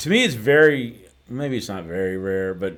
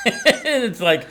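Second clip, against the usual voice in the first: words squeezed out through a laugh. Real, not acted. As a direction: still laughing while talking. Laughing at own air-instrument joke.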